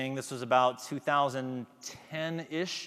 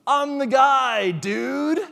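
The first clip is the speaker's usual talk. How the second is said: much higher and louder, slow, cocky brag; swaggering catchphrase; 11 semitones higher, 11 dB louder, much slower than usual. Retold or imitated. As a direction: shout it like a show-off contestant. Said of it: "he comes out with this swagger".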